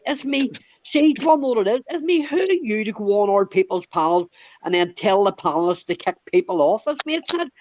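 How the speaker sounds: high-pitched, worked-up retelling of a row; retold heated rant. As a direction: replay the argument, voice climbing high with indignation. Retelling his rant at Mac.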